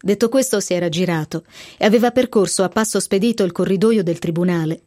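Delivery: usual speaking voice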